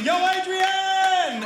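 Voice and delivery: high, drawn-out hollered call; hammed-up boxer's yell; Rocky. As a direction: holler it long like a movie boxer. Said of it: "a cross between Rocky and Forrest Gump".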